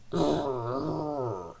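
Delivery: long rolling engine growl. A narrator's reading. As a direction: growl out a drawn "grrr". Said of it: "he growled".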